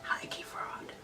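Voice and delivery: breathy whisper aside. Real, not acted. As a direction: whisper it like a secret. Whispered confession joke.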